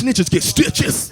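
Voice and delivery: mock warning. Mocking idea of calling police.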